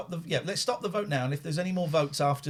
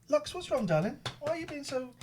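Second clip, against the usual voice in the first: high cooing pet voice; 13 semitones higher than usual. Real, not acted. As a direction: coo at the cat. Talking to her cat.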